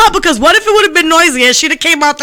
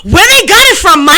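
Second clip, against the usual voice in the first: higher, louder eager question; mock eager friend; 4 semitones higher, 5 dB louder than usual. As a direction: play her keen to buy. Imitating friend asking.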